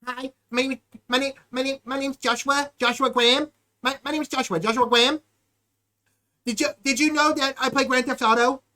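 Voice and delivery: halting, stuttered imitation of a cellmate; put-on accent with repeated false starts; mocking impression. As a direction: mimic someone tripping over his own introduction. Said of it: "I mastered his accent".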